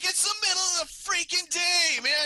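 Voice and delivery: high, loud mock yell back; angry retort. Jerry yelling back at the neighbor lady, high loud mock yell. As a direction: shout her off the lawn, pitched high. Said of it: "he's like".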